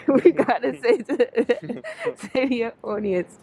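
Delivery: laughing through the words. Real, not acted. Teasing him about his audience, laughing. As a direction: giggle while prompting him to speak.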